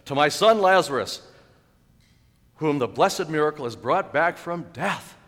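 proud host's declamation; father's toast. Acted. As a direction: propose a grand toast to the room. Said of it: "with a toast".

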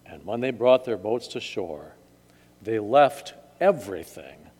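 measured gospel reading with solemn pauses; scripture reading. A narrator's reading. Pastor ends the gospel for church, solemn reading. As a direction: close the reading reverently.

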